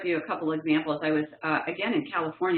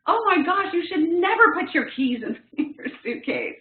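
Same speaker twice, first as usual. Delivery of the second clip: higher, louder, lecturing a tired friend; bonehead know-it-all advice; 11 semitones higher, 4 dB louder than usual. Retold or imitated. To a frustrated friend after lost bag, lecturing. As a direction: blurt out the fix like a know-it-all. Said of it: "in the most bonehead move".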